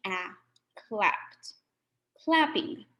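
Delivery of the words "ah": short vowel "ah" said clearly; short a sound. Shown as a demonstration. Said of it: "the A can still say its short name".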